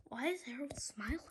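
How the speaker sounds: unvoiced whisper; a secret aside to the listener. A narrator's reading. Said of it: "narrator whispers aside".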